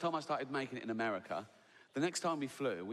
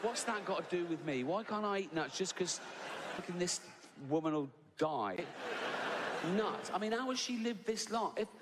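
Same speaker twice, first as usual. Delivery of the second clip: outraged rant; 5 semitones higher than usual. Real, not acted. Ranting in outrage.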